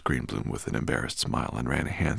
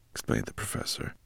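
ordinary delivery, nothing marked

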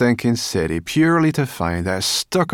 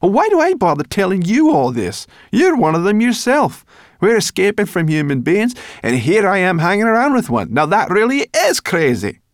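much higher and louder, building anger; furious little creature tirade; indignant brownie rant; 10 semitones higher, 7 dB louder than usual. Acted. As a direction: scold him harder and harder. Sorrel rants at the human boy.

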